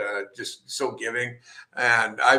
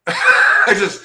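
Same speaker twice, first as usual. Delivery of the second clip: laughing, louder, half voiceless; words lost in laughter; laughing breathily; 9 semitones higher, 11 dB louder than usual. Real, not acted. Laughing at his own nerves over meeting Bloom.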